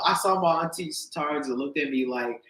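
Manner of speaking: ordinary talk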